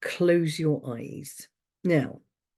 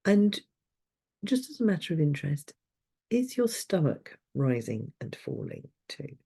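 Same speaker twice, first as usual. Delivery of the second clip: calm, unhurried guiding voice with long pauses; guided meditation. Acted. Leads a breathing meditation.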